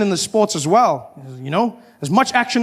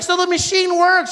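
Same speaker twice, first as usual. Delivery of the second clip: innocent woman; 12 semitones higher than usual. Retold or imitated. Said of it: "She's like".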